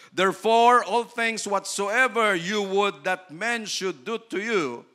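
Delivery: verse read out slow and measured; scripture reading. Acted. Pastor reading Matthew to the congregation, slow and solemn. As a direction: read the verse like holy writ. Reads Bible verse aloud.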